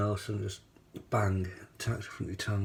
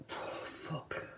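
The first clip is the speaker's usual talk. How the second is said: pained whisper; 12 dB quieter than usual. Real, not acted. Burning mouth from hot pepper.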